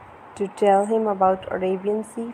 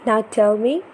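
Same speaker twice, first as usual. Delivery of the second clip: put-on lively, sing-song storybook reading for a toddler; reading to children; 3 semitones higher, 3 dB louder, slower than usual. Parent reading an ocean picture book to baby, animated storytelling. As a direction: read it brightly to hold a little one's attention.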